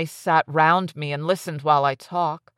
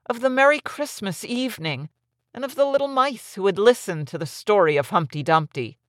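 ordinary delivery

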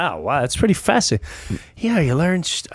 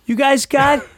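much higher and louder, fast and disbelieving; can't believe how far they took it; astonished; 9 semitones higher, 5 dB louder than usual. Real, not acted. Host stunned by how far the teacher took the prank, rushed disbelief.

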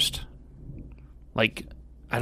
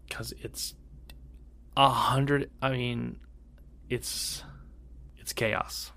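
whispering; 10 dB quieter, slower than usual. Real, not acted. Hushed reaction to shocking photo.

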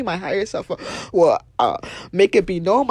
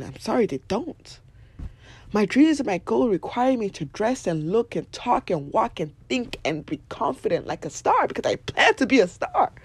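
ordinary talk

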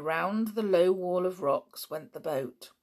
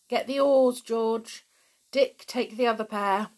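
raised-voice orders; crying out; 6 semitones higher, 7 dB louder than usual. A narrator's reading. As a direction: call instructions to the rowers. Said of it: "cried Julian".

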